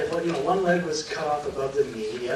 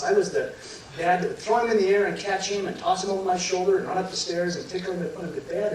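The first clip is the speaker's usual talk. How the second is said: ordinary talk, nothing marked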